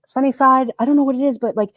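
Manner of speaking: ordinary talk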